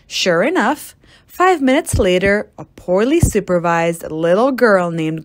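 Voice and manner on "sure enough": usual speaking voice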